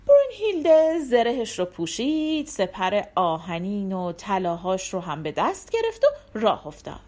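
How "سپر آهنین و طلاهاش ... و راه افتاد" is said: ordinary delivery, nothing marked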